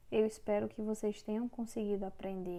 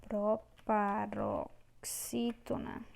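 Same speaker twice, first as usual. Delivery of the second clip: Proparoxítona stretched out very slowly; teacher drilling the term for the class; slow syllables; 3 dB quieter, much slower than usual. Shown as a demonstration. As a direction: spell out Proparoxítona beat by beat. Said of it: "a sílaba tônica é a antepenúltima".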